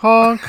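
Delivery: high loud goose honk; honk buzzer. Pretend. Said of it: "what's your buzzer?".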